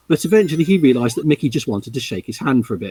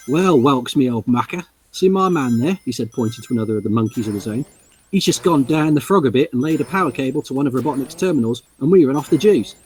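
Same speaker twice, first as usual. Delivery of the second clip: cheery Cockney geezer, lightly done; Cockney monkey. Acted. Mickey the monkey greets the heroes, in light Cockney. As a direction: read it as a chirpy Cockney barrow boy. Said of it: "by that he means Cockney".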